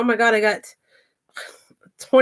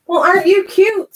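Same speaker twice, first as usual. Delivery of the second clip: higher, sing-song pet talk; cooing; 8 semitones higher, 4 dB louder than usual. Real, not acted. Cooing at her cat, high and sweet. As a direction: coo at the cute animal.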